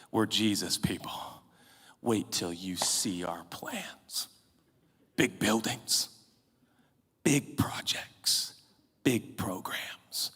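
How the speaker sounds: hushed, slow, secretive whisper; mock whisper. Preacher mocking church plans in a slow whisper.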